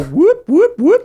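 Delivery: high, loud whooping siren noise; robot siren. Pretend. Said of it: "the robot will just go".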